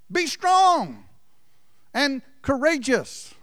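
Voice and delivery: rising exhortation; fervent exhortation. Real, not acted. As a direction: preach it up high like a command from God. Preacher exhorts, quoting God.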